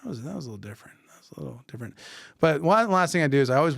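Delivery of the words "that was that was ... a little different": very soft private aside; thinking to oneself. Retold or imitated. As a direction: say it under your breath, as a thought. I'm thinking to myself.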